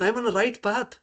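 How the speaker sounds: high-pitched voicing of self-assurance; confident. Teacher voicing a devotee sure of the path, confident. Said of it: "That's give the confidence".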